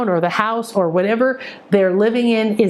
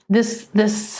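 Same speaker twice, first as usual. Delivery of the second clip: ordinary talk, nothing marked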